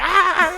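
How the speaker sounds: high, loud mock howl; hammed-up burst of laughing "ah"; imitated laughter. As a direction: imitate friends cracking up. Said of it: "You're just fucking laughing".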